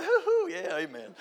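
high whooping amen; mock congregation. Congregation answering the choir, high whooping amen. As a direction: holler it up high like a fired-up churchgoer. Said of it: "They're like".

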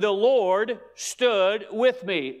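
slow, deliberate proclamation; stretched-out, weighty verse; emphatic scripture quote. Acted. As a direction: declare it word by word.

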